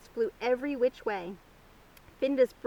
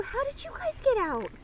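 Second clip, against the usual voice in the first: astonished question; 12 semitones higher than usual. A narrator's reading. Petson questioning escaped hens.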